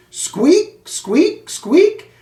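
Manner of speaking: hamster squeaks. Acted.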